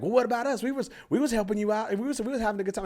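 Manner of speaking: high-pitched mock complaining voice; indignant couple. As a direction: whine it up high as the offended couple. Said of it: "This other couple got mad at me".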